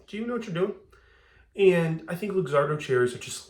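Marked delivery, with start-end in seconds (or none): 0.1-0.7 high, mock-incredulous question; playfully doubt the bartender's skills; incredulous judge. Judging a bourbon bar without Luxardo cherries, high mock disbelief. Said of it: "I'm gonna give you that weird look like".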